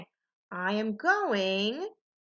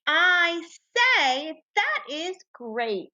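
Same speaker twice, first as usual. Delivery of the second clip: higher, slow, cheerful model read; model sentence read aloud; 7 semitones higher, 3 dB louder, much slower than usual. Shown as a demonstration. Teacher models the sentence for students to echo, excited and slow. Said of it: "Go ahead and be my echo".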